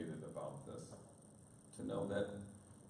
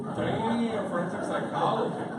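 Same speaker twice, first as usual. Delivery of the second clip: much louder and higher, impressed disbelief; amazed exclamation; 11 semitones higher, 11 dB louder than usual. Real, not acted. Priest impressed by the daughter's answer, loud amazed repeat. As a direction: repeat it loudly, blown away.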